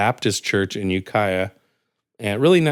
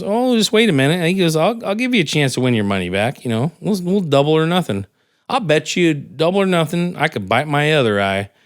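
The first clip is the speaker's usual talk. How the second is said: put-on much higher crafty old man; lightly voiced old trickster upping the bet; sly high old-timer; 6 semitones higher than usual. Old guy voiced in joke.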